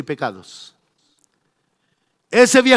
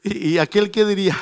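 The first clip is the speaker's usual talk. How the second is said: ordinary delivery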